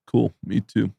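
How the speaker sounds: low, soft deadpan brag; casual understated boast; Judah Friedlander. Retold or imitated. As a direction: lightly imitate an unimpressed one-upper. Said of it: "And he goes".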